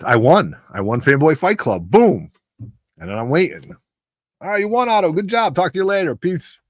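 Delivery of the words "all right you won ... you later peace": high, breezy mock host voice, pitched up; imitating the host. Host dismissing the winner after the debate, high breezy mock voice. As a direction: toss it off lightly, like a busy host wrapping up. Retelling host's brush-off after win.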